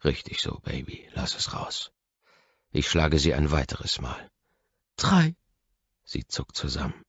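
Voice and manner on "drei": high, louder cry of the count; a sharp high yelp; pained cry. A narrator's reading. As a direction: scream the number out. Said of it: "Drei Striemen".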